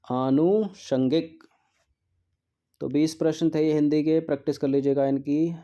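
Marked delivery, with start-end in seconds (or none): 0.0-1.4 आनुषंगिक stretched slowly, a-nu-shan-gik, rising at the end; teacher slowly sounding out आनुषंगिक. Shown as a demonstration. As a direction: draw out आनुषंगिक like a question to the class. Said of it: "क्या होगा?".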